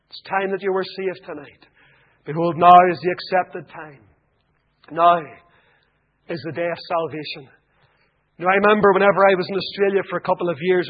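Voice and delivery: ordinary talk, nothing marked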